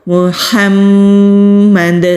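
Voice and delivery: محمد slow, nasal hold on meem, echoing bounce on the final dal; qalqala on dal. Shown as a demonstration. Teacher demonstrates qalqala stopping on محمد. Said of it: "اگر آپ رکیں گے تو اس طرح رکیں گے".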